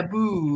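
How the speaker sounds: "boo" with a long oo; mock-British "book". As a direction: stretch the vowel of "boo", imitating "book". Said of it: "Are you allowed to say book".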